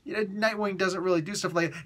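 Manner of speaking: ordinary talk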